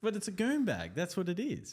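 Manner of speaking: squeaky mock outrage; high indignant protest. Real, not acted. Insisting on goon bag.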